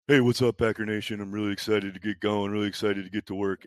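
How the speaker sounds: lightly voiced stiff rookie greeting; mock rookie intro. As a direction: parody the scripted draft video lightly. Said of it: "that hostage video they make everybody do".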